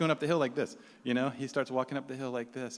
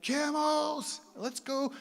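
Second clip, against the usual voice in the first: very high thrilled cry; mock excitement; 13 semitones higher, much slower than usual. City guys spotting camels, wildly excited.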